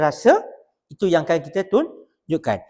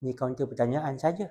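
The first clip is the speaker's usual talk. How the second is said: dry quiet retort; 6 semitones lower, 11 dB quieter than usual. Retold or imitated.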